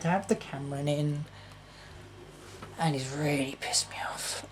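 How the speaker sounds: quiet, whispered grumble; bitter whisper. Real, not acted. Vlogger fed up with Cameron, whispered grumble. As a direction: grumble it under your breath. Muttered complaint about Cameron.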